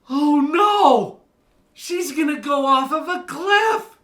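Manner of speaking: high, loud alarmed cry; panicked parent. Retold or imitated. Parents looking down at their hiking daughter, panicked high cry. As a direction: gasp it out like a worried parent.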